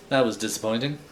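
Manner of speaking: ordinary delivery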